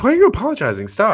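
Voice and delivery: begging her to stop apologising; a viewer shouting at the screen, high and exasperated; exasperated plea. Retold or imitated. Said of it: "I'm like".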